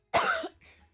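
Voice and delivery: sudden hard cough; loud cough. Real, not acted. Reader coughs hard mid-session.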